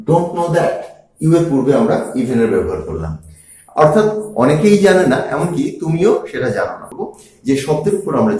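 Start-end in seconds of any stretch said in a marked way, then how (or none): none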